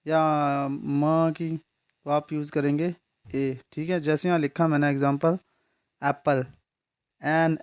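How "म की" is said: म held very long as a bare consonant sound; lingering "ma" on म to show the sound; drawn-out sound. Shown as a demonstration. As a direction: stretch म slowly before की. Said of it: "किसी और चीज़ की sound आती है".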